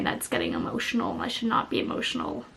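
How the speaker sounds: breathless whisper, quick, to herself; whispered aside. Real, not acted. Vlogger scolding herself for getting emotional, whispered. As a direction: hush it out like a private scolding.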